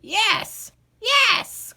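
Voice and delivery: high, rising eager yelps; old man jumping up and down, excited; excited cry. Acted. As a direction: bounce with eagerness. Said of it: "cried the old man, jumping up and down".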